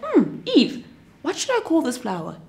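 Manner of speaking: lightly voiced Adam, pondering; playful Adam. Adam asks Eve about a flower, lightly voiced.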